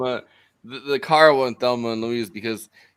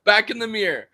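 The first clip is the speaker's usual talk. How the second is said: raised, higher-pitched command; mock banishing; 8 semitones higher, 6 dB louder than usual. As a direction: order it back, louder and higher. Acted banishing of a figure in mirror.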